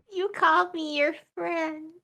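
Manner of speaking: soft high clingy voice; a touch of adoring character voice. Acted. Phoebe clings to Gorbert.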